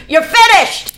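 loud, high yell down the hall; triumphant shout. Retold or imitated. Father shouting the news down the hall, loud and triumphant. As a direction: shout it like a father who's won. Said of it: "My dad goes".

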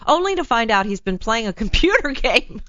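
amused read, breaking into laughter; laughing. Real, not acted. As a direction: read it out and crack up at the end. Amused reading a listener's letter.

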